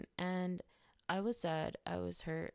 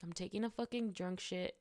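ordinary delivery

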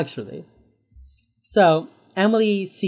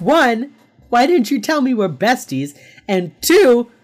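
raised, louder hammed-up exasperation; mock exasperation; 5 semitones higher, 5 dB louder than usual. Hotch lecturing his bestie J J, mock exasperated. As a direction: count off grievances at a close friend, pitched up. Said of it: "so exasperated".

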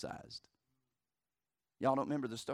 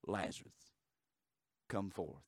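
ordinary talk

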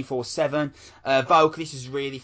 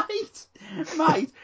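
laughing; 5 dB louder than usual. Real, not acted. Laughing excited exclamation.

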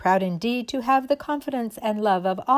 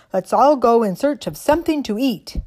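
a hint of a toy soldier, louder; stiff barking toy soldier; 4 semitones higher, 8 dB louder than usual. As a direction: call out like a little tin soldier. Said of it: "cried the tin soldier".

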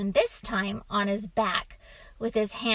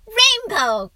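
high, loud shouted guess; excited cry; 12 semitones higher, 10 dB louder than usual. A narrator's reading. Boy guessing what the wolf found, excited cry. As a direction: cry it out like an eager child. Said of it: "cried the boy".

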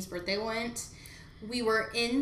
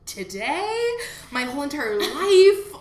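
incredulous; 6 semitones higher, 12 dB louder than usual. Retold or imitated. Sarcastic retort retold.

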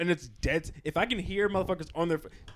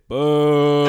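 mock internal boo; 3 semitones lower, 12 dB louder than usual. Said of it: "internally I said".

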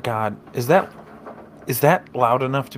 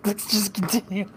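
laughing; 10 semitones higher than usual. Real, not acted. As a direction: still giggling, trying to compose. Repeat through laughter.

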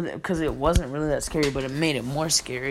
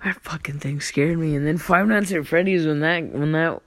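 ordinary delivery

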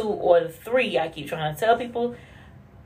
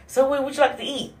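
a lightly voiced sweet question, higher and fast; a hint of a woman asking her silent man; 6 semitones higher, faster than usual. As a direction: ask brightly and quickly like a chatty partner. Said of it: "a woman asks you".

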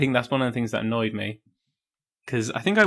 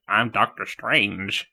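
slow, lower, drawn-out self-introduction; Doctor Strange; 3 semitones lower, much slower than usual. Acted. Podcaster retelling the Spider-Man joke, slow and self-important. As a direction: announce your own name slowly and grandly. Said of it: "Doctor Strange is like".

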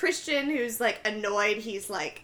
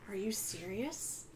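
hushed, softer retold question; quiet disbelief; 3 semitones lower, 14 dB quieter, slower than usual. [name] quietly stunned by the festival plan, in a hushed voice. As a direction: drop the voice in stunned doubt.

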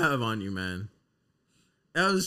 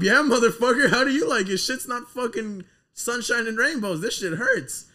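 mock taunting shout; 8 semitones higher, 5 dB louder than usual. Taunting a cop with light.

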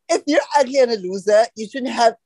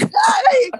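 shrill shouted hey. Real, not acted. High shouted greeting.

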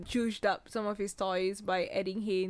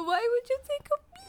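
high panicked cry; faster than usual. Real, not acted. Frightened protest.

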